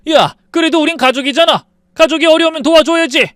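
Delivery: high, loud roaring shout; angry bellow. Acted. 시아버지가 며느리에게 버럭 높고 크게 소리침. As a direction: bellow at her like an enraged father-in-law. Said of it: "시아버지가 버럭 소리쳤어요".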